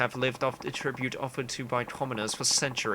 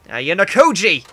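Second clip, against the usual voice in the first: loud, higher-pitched bellow of the name; scary shout; 5 semitones higher, 13 dB louder than usual. Acted. Scolding a balking aristocrat friend, scary shout. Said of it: "there's that scary voice again".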